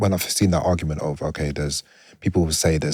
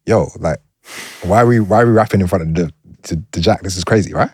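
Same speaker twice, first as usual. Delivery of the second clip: loud, disbelieving outburst; incredulous exclaim; 3 semitones higher, 7 dB louder than usual. Retold or imitated. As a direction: react like it's outrageous. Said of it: "I'm like".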